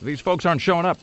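hammed-up Obama rally to an empty room; mock Obama in an empty hall. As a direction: play Obama addressing a hall nobody came to. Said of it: "I'm picturing just a big sort of echoing hall".

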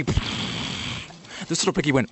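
slow breathy whisper. Acted. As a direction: whisper it very slowly. Nursery rhyme, whispered.

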